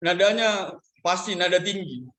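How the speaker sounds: ordinary talk, nothing marked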